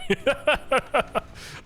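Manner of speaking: high burst of laughter; laughing. Real, not acted. Caster laughing at his own German accent, amused. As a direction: crack up at your own attempt.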